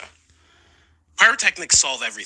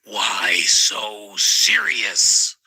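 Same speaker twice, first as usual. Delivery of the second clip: slow drawn-out Joker line; Joker imitation; 7 semitones lower, much slower than usual. Teachers quoted in slow menacing Joker voice. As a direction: stretch it out like the Joker. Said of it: "they'd say".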